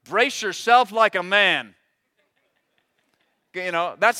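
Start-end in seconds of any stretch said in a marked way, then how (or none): 0.0-1.8 slow, louder, commanding; stern God voice. Retold or imitated. God commands Job, slow and louder. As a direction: lay down the command slowly. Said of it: "great words from God".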